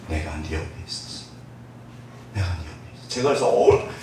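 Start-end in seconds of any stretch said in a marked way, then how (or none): 0.1-1.2 much softer, lower tender reply; gently as God answering. Acted. God's reply in sermon. 2.3-3.1 much softer, lower tender reply; gently as God answering. Acted. God's reply repeated.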